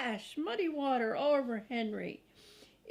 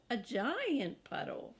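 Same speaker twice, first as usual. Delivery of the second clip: ordinary delivery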